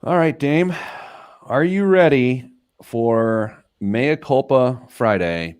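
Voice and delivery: big, slow announcer build-up; drawn-out show-intro voice; segment announcer. Acted. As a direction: hype the segment like a radio host.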